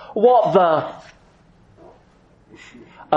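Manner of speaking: high, cut-off oath of alarm; a king stopping himself mid-curse; shocked oath. Retold or imitated. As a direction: blurt it out in shock. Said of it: "is probably the sense of it here".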